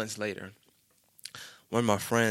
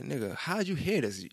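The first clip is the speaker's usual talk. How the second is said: incredulous question; 4 semitones higher than usual. Retold or imitated. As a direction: act out being baffled that word got around. Retold surprise that news had spread.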